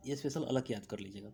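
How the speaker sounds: ordinary talk, nothing marked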